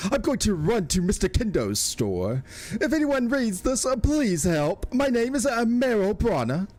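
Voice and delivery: high, hammed-up British kid voice; British small child. Streamer voices a child's plea for help, in a high, badly faked British accent. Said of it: "my best attempt at a British accent".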